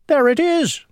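put-on high-pitched young prince spotting home; excited cry. A narrator's reading. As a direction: cry out in delighted discovery. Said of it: "he cried".

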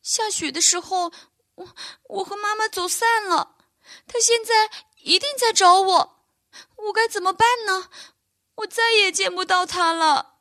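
high, choked voice on the edge of tears; a lost little one fighting back sobs; tearful fawn. A narrator's reading. Lost fawn tells the bear he lost his mother, high and tearful. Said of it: "他就大哭起来".

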